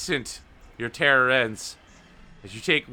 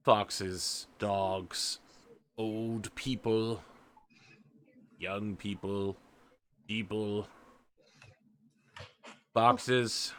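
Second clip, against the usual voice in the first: grave elder warning; 2 semitones lower, slower than usual. Acted. Gray elder warns Blackberry.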